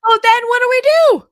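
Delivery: high, loud, lost question; bewildered question. Retold or imitated. Mom imitating her own lost question, high and loud. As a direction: ask it as if completely stumped. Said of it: "then I was like".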